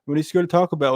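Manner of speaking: ordinary talk, nothing marked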